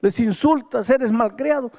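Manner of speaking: high, forceful preacher scolding; stern preaching rebuke. Retold or imitated. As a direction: hammer it home like a pulpit rebuke. Pastor rebukes the injured man. (reprimenda de predicador, aguda y enérgica)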